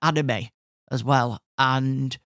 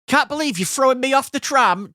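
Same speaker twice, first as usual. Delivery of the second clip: high, projected grievance for onlookers; loud public complaint; 9 semitones higher, 4 dB louder than usual. Retold or imitated. Passenger complaining so that everybody could hear, indignant and raised. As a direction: protest so the whole carriage hears. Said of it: "so that everybody could hear".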